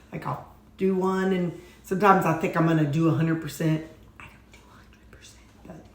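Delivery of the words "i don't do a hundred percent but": unvoiced whisper aside. Real, not acted. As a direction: whisper it like a secret. Confessing aside.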